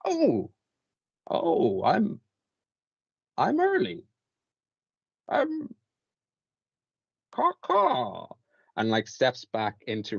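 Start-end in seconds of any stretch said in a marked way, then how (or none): 0.0-8.3 put-on high, squeaky fluttering old woman turning raven; a startled little crone trilling up into caws; flustered old woman. The old woman, caught early, squeaks and caws before flying off. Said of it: "turns around and goes".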